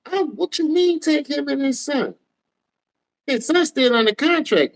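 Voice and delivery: can't believe what he's hearing; high outraged disbelief; incredulous. Real, not acted. Incredulous at Cleveland trade rumor.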